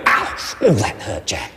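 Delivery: mock hurt "ow"; demo hurt cry. Shown as a demonstration. Trainer showing owners how to react to mouthing, mock hurt. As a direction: complain like a light nip really hurt. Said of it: "we're gonna go".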